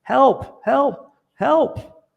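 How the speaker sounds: very high, loud cries for help; frantic high-pitched yelling for help; screaming for help. Retold or imitated. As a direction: shriek up out of the hole. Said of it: "He's screaming".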